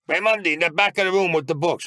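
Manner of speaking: retelling the nun's command to a mischievous pupil, mock stern; stern nun's order. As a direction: imitate the strict nun sending him back. Nun ordering him to back.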